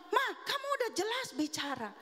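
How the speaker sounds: high-pitched delighted discovery; retold amazement. Husband telling wife her speech is clear, retold amazement. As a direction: exclaim it high, as retold good news.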